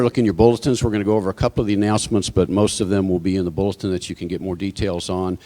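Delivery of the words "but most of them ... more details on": usual speaking voice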